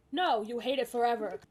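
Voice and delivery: ordinary delivery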